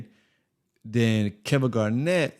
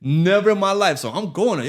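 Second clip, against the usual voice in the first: usual speaking voice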